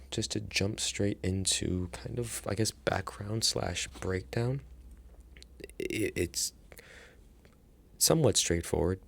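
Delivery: ordinary talk, nothing marked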